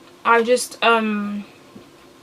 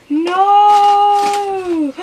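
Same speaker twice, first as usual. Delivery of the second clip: shocked gasp; 10 semitones higher, 6 dB louder than usual. Real, not acted. Wrong sauce discovered.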